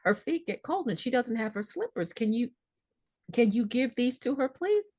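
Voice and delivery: put-on childlike plea. Daughter pleading like a child.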